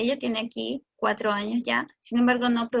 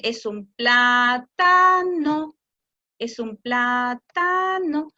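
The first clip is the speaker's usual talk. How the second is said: es un plátano very slow, with a playful melody; singsong intonation; 2 semitones lower, 4 dB louder, much slower than usual. Shown as a demonstration. Parent repeating plátano to child in singsong, very slow. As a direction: lilt it slowly like a tune. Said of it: "si usamos una entonación diferente".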